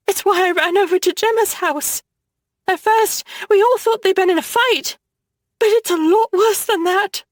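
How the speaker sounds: a scared sister telling bad news; upset little sister. Upset younger sister Nora speaks.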